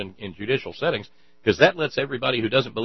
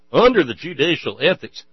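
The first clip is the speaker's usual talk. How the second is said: mocking echo; 6 semitones higher, 10 dB louder than usual. Echoing Moore's phrase mockingly.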